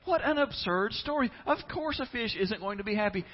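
high, loud imitation of a scoffer; mock indignation. Listeners reacting to the fish story, mock indignation. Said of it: "we look at that and go".

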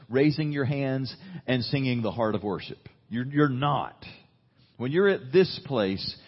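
fervent pulpit preaching; preaching at full force. Acted. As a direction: press the point home to the congregation. Impassioned preaching run.

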